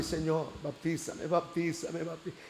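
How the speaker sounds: súplica baja que se va apagando; rezo imitado. Retold or imitated. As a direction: repetir el ruego en voz baja, para sí. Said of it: "¿saben cómo me ponía?". (plegaria murmurada, cada vez más tenue)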